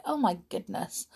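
quiet aside. Real, not acted. Repeats exclamation softly.

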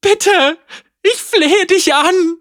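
high, loud, crying plea; desperate whimper. Acted. Tamara on her knees begs Caitlin, crying. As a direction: cry out in panic, begging. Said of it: "Wimmerte sie".

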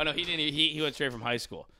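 ordinary delivery

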